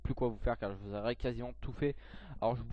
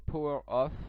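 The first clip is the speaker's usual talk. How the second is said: English words in a French accent; bad English accent; 3 semitones higher, 4 dB louder, slower than usual. Real, not acted. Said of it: "désolé pour mon accent anglais pourri". (mauvais accent anglais, à la française)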